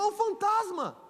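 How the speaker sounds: grito agudo e assustado de "é um fantasma"; grito de susto dos discípulos. Retold or imitated. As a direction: gritando de medo ao ver um fantasma. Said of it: "E eles gritam". (discípulos gritando de medo, bem mais agudo)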